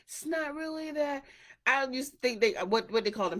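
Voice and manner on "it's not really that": imitating a laid-back hippie. The relaxed people in videos, a high mock hippie voice. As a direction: call out a carefree hippie plea. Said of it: "videos of the people like".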